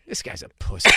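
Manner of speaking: muttered under the breath, nearly voiceless; muttered aside. Retold or imitated. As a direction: mumble it as a snide aside to yourself. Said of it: "he just mutters".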